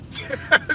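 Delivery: short high burst of laughter; a quick high laugh at his own joke; laughing after the punchline; real laugh. Real, not acted.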